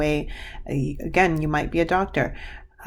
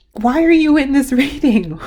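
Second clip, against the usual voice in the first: high, loud, laughing through the question; overwhelmed, laughing it out; laughing in disbelief; 9 semitones higher, 5 dB louder than usual. Real, not acted. Reader overwhelmed, laughing mid-question.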